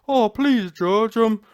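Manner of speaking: loud, hammed-up courtroom begging; mock a tearful plea for mercy; mock crying. Mocking convicted gang members pleading to the judge, mock crying. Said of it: "they're all crying".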